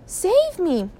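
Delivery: high panicked plea; frightened cry. A narrator's reading. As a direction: call out for rescue. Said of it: "she cried".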